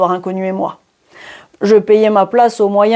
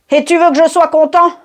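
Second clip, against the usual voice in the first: indignant; 7 semitones higher than usual. A narrator's reading. Luckless man complains to old friend.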